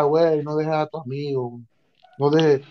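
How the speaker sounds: low, soft voice of conscience; lightly voiced angel, low and hushed; little angel. Little angel urging him not to leave friend, softly. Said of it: "el angelito me decía".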